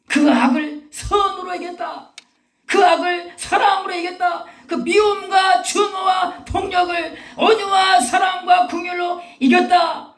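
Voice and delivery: high, loud, rhythmic triumphant proclamation; full-force preaching. Real, not acted. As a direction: shout each phrase to the congregation as a victory cry. (승리를 힘차게 선포하는 설교)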